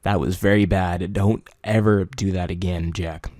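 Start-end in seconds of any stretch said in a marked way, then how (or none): none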